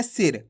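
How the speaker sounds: सिर said fast with a short "i"; quick reading. Shown as a demonstration. Teacher corrects the long reading, सिर said quickly. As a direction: say सिर short and low, no stretching. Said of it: "जल्दी से पढ़ देंगे".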